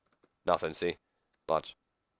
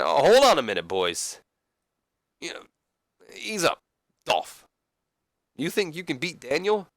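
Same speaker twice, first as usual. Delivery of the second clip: higher, halting moderator voice; put-on higher voice of a host; lively moderator; 7 semitones higher than usual. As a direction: step in between squabbling wrestlers, pitched up.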